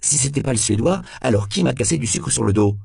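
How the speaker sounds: high, loud protest; rattled suspect demanding an answer; agitated demand. A narrator's reading. Accused suspect demands the source.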